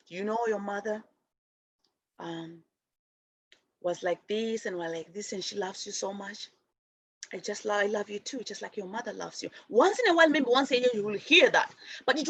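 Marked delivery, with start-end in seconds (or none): 0.1-9.5 soft, low reassuring voice; a hushed elder talking about a child's mother; gentle retold elder. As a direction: comfort a small child quietly.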